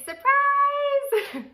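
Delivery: high, loud celebratory squeal; excited announcement. Real, not acted. Vlogger revealing her pregnancy to viewers, excited squeal. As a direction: shout it out high and drawn out.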